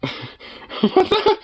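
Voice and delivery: sudden high squeal, then breathless laugh; startled squeal. Real, not acted. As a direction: yelp as the dog licks your ear. Dog licks into his ear.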